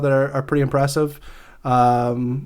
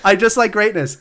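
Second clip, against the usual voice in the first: higher-pitched, through laughter; laughing; 6 semitones higher than usual. Real, not acted. Golf fan resumes after partner's joke, laughing. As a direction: say it while still laughing.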